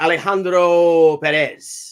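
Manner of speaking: showy mock Spanish-accented name announcement.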